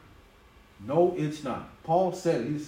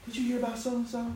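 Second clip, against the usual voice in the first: higher, softer nosy gossip voice; mock gossip; 9 semitones higher, 4 dB quieter than usual. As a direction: lean in like a busybody. Imitates a gossip.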